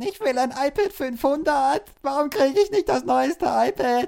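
put-on high, slow whiny child demanding; whiny kid voice. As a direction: beg like a spoiled kid. Kid demanding iPad at Christmas. (quengelndes Kind)

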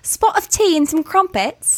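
put-on playful British accent; mock British accent. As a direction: tease with a hammed-up English accent. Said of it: "Our accents are garbage".

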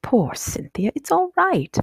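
high, cooing comfort; soothing mother. A narrator's reading. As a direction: comfort a child who is near tears. Mother comforts tearful daughter.

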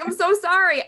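high, loud panicked sorry; frantic mock apology. Retelling jumping away from dad's twitch, panicked high apology. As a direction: blurt the apology in a retold panic. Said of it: "and I'm like".

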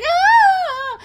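high, loud shriek breaking into laughter; mock scream with a laugh. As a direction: laugh through a fake scream. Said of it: "She's like".

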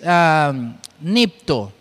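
ordinary talk, nothing marked

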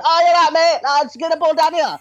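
very high, excitable mock Spanish-London accent; hammed-up Hector Bellerin accent, squeaked high; cartoon Spanish accent with London slang; Speedy Gonzalez-like accent. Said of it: "you think Speedy Gonzalez".

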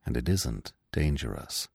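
gentle, low reassurance; softly calm the young deer. A narrator's reading. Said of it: "the old stag began softly".

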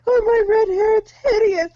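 high, loud, sobbing mockery; mock crying wizard. Friend mimics wizard sobbing over hair, high mock cry. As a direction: whimper it like a vain wizard. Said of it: "he's talking about".

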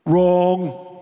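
loud, drawn-out interjection; Trump imitation. Preacher rejects the crowd's answer, loud mimicking interjection. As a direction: cut in loudly and hold the word. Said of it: "That's my Trump imitation".

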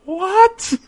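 high-pitched incredulous squeal; hammed-up, high incredulous reaction; mock disbelief. Acts out disbelief at the rejection.

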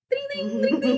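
very high, ringing ding-ding; bicycle bell imitation. Showing the wrong kind of Halloween biker, high bell imitation.

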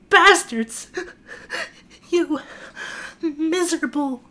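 high, sobbing insult; crying out. Acted. Mrs Tucker weeping at her captors, high sob. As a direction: choke the words out through tears. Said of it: "that was supposed to be, like, a louder noise".

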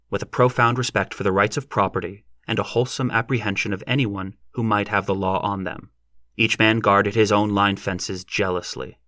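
usual speaking voice

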